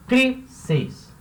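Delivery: "Crisis" split in two, first syllable high; stress on first syllable. Shown as a demonstration. Teacher showing which syllable is strongest, first-syllable stress. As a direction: punch the CRI of "Crisis", then drop on sis. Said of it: "a sílaba mais forte".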